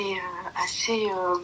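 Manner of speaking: whispered, almost no voice; real whisper to the host. Real, not acted. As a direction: keep it hushed and private. Caller speaking low on stream.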